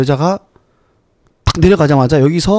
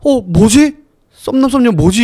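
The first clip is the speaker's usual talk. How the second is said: higher, startled wondering; puzzled surprise; 8 semitones higher, 4 dB louder, slower than usual. Retold or imitated. Couple stunned after crashing into an invisible wall, surprised. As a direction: sound caught off guard. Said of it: "이러고 있었어요".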